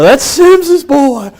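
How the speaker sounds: put-on high, very slow drawn-out old man; Bob Smith. Old family friend pointing him out at the funeral, high slow drawl. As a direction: drag out That's Sims's boy like the old man did. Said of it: "you know".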